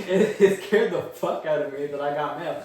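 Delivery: loud, laughing confession. Real, not acted. Speaker admits mail scared them, laughing loudly. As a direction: burst out laughing at your own fright.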